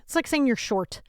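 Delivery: normal talking voice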